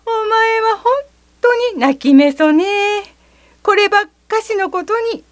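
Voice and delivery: high, quick teasing voice; teasing the crybaby. Acted. Narrator teasing the boy over a tiny hurt, playfully. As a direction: tease the little crybaby. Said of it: "と、私が言ったと言って".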